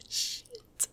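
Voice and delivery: breathy whispered curse. Real, not acted. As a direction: hiss it under breath.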